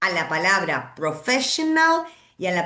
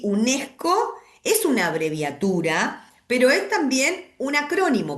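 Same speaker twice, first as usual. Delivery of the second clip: usual speaking voice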